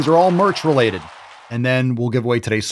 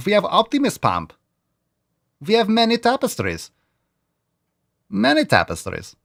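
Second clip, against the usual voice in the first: playful Scottish brogue, pitched up; Scottish accent; 5 semitones higher than usual. Retold or imitated. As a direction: keep hamming the castle-guard accent. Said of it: "with an accent".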